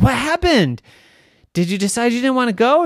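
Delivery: high, loud eager questions; surprised mother. Acted. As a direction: ask like an astonished mom. Surprised Mommy asks.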